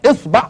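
"إصبع" with i then a, isbaʕ; pronunciation variant. Shown as a demonstration. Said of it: "يا تفتحها يا تكسرها".

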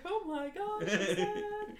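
high, laughing mock sob; mock weepy voice. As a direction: squeal it out, mock sad. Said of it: "I was just like".

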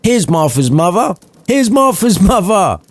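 put-on children cheering their sister home; joyful cry. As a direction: shout it gleefully like small kids. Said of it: "cried the same with joy".

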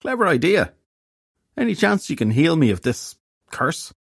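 high, plaintive character voice; hungover fairy detective, hopeful. A narrator's reading. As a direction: ask feebly for relief from misery. Hungover detective begs for a cure.

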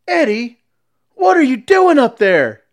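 high, loud shout across the ramp; angry yell. Retold or imitated. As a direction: yell at Eddie from the shore. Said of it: "that's yelling at Eddie".

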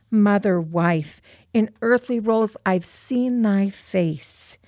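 measured, deliberate verse with pauses between phrases; poem recitation. Acted. Daughter reciting mother's poem to friends, measured recitation. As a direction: recite it as a heartfelt prayer.

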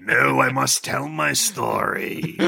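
slow, drawn-out villain voice; insistent Palpatine. Acted. Storyteller refusing to be silenced, grandly insistent. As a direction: insist theatrically, stretching the words.

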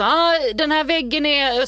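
wife mimics husband guessing wall materials; mock clueless handyman. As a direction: fake expertise about the wall. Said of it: "Du börjar så försöka så".

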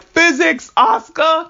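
high, loud shout of Physics Asuka; cheering at the rescue. Retold or imitated. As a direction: yell it out like a hero's cue. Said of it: "Shinji was like".

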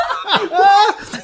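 loud, high real laugh; high burst of laughter. Real, not acted. Guest laughing as his mum is guessed. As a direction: crack up at the punchline. Host's mum bought the deal.

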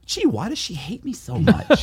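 high, whiny, laughing through it; mock whine. Wondering why the agent hates him, a high laughing mock whine. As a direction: play the hurt victim with a grin.

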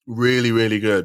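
chewing while talking; words round a bite of biscuit; mouth full. Real, not acted. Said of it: "talking with my mouth full".

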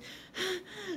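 high, breathy theatrical gasp; hammed-up horrified gasp; mock dramatic gasp. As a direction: gasp like a soap opera shock. [name]'s dramatic reaction.

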